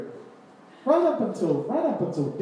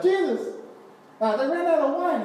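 high-pitched, loud mock mother calling her son; Mary appealing to Jesus; 9 semitones higher, 4 dB louder than usual. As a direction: call out to your son in a raised, high voice. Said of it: "she goes up to Jesus and she's all like".

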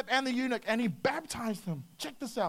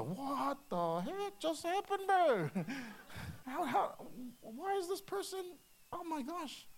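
mock-stunned, high-pitched and hushed; baffled; 6 semitones higher, 4 dB quieter, slower than usual. Walking away from that meeting amazed, mock bafflement. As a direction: act out dazed disbelief in a high, soft voice.

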